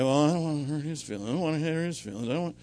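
hurried, mumbled-together mock excuse; mock reluctant excuse. Voicing someone keeping it under the radar, mock reluctant. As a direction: rattle it off like someone dodging a confrontation. Voicing people avoiding confrontation.

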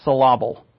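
"syllable" said as suh-LAH-bul; jokey mispronunciation. Shown as a demonstration. Preacher joking about emphasis, "syllable" mispronounced as suh-LAH-bul. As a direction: put the stress wrongly on LAH in "syllable". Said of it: "It's syllable. I know that".

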